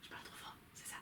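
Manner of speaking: barely audible, voiceless whisper. Real, not acted. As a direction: whisper it to check the volume. Checking volume after being too loud.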